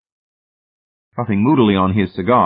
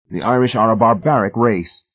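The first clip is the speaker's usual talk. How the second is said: louder, higher, needling relish; sadistic relish; 4 semitones higher, 5 dB louder than usual. A narrator's reading. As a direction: needle them with cruel pleasure. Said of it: "continued with sadistic pleasure".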